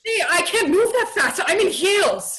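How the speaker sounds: panicked protest in heels; desperate plea. Acted. Observer dragged to paddy wagon.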